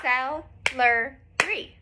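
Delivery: celery very slow, each syllable apart; cel-e-ry, slowly clapped. Shown as a demonstration. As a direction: stretch celery out, one clap per syllable. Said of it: "let's all do it together".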